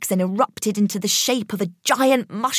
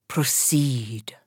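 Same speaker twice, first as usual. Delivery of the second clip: whisper; 5 semitones lower than usual. A narrator's reading. Whispered go-ahead at seance.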